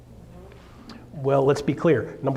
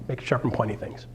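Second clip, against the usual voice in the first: whisper; 4 semitones lower, 6 dB quieter than usual. Real, not acted. Aside about knives.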